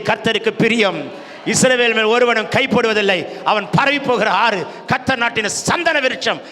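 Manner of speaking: loud, mounting sermon declaration; impassioned, booming proclamation; full-force preaching. Real, not acted. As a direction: build each phrase bigger for the congregation.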